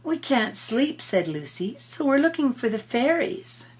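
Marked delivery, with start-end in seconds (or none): 0.0-1.0 put-on higher, slow plaintive girl; lightly voiced little girl. Lucy telling Turtle they can't sleep, light plaintive girl voice. 1.8-3.7 lightly voiced little girl. Lucy telling Turtle they can't sleep, light plaintive girl voice.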